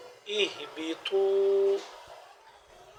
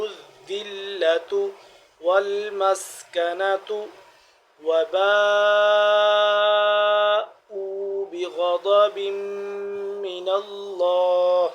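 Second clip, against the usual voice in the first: slow, loud, higher chanted recitation; Quran recitation; 3 semitones higher, 7 dB louder, much slower than usual. Shown as a demonstration. Teacher chants the verse slowly and loudly for students.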